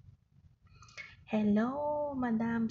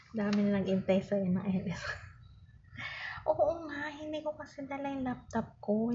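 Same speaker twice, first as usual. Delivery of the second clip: usual speaking voice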